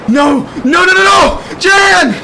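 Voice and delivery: high, loud desperate shout; horrified scream. Acted. Friend watching Jan go off the ledge, horrified scream. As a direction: scream to stop them, rising in horror.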